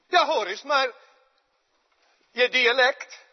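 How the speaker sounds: loud, high, pressing and quick; insistent accusation. Acted. Maid insisting Peter is from Galilee, loud insistent. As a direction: hammer home the accusation.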